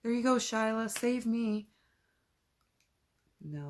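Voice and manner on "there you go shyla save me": higher, louder, hammed-up helpless plea; mock-beg the dog to save you; mock damsel in distress. Mocking her mom's idea of using the dog as bear bait, hammed-up. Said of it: "It's like".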